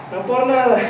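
ordinary talk

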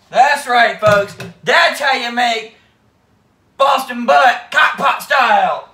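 belted out, slow and loud; slow triumphant holler; proud shout. Real, not acted. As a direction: announce it like a TV chef. Proud sign-off on the recipe.

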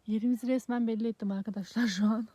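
ordinary delivery

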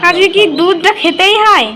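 loud, slow, high question; calling out. Real, not acted. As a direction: call across the house, drawn out. Calling out to grandmother.

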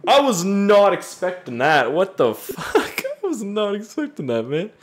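high, startled, laughing disbelief; genuinely floored, voice jumping up; real amused shock. Real, not acted. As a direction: blurt it out high, cracking up midway. Shocked by a crude message.

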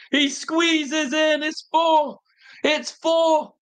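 slow, drawn-out shouted repeat; live fan bellowing the goal; stretched shout. Real, not acted. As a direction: shout it again, dragging every word.

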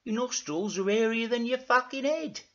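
high, teasing character voice; mocking, high-pitched banter; Dougal. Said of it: "said Dougal".

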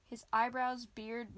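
usual speaking voice

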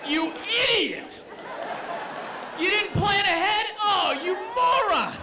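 hammed-up girlfriend yelling in the rain; furious girlfriend. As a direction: screech at him for not planning ahead. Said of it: "she'd be like".

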